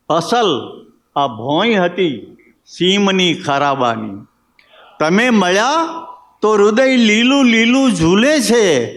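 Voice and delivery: lilting verse delivery with phrases set apart by pauses; ghazal recitation. Acted. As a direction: recite each line, then lift it on the repeat. Poet recites own ghazal.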